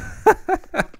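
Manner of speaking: short high burst of laughter. Real, not acted. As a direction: crack up while losing the thread. Laughs at failing to recall game.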